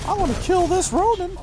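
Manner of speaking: high, fast shout at the game; screeching at a Ronin; frustrated gamer yell. Real, not acted.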